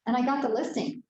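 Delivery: ordinary talk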